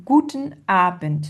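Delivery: "Guten Abend" with GU- and A- stressed; accent the first syllables of "Guten Abend"; stress at start. Shown as a demonstration. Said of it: "on a l'accent du mot".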